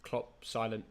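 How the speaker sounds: very soft, barely voiced commentary; respectful low murmur; hushed. Real, not acted. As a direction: keep it right down during the silence. Minute's silence at the stadium.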